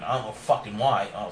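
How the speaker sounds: usual speaking voice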